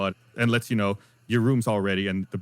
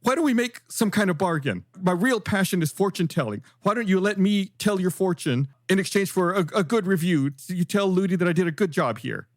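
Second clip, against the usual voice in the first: high-pitched, hopeful character voice; rejected male pie bargaining; 9 semitones higher than usual. Acted.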